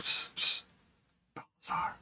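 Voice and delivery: hushed unvoiced whisper. Game master whispering to get the prisoner's attention. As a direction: whisper his name from the dark. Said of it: "he kinda, like".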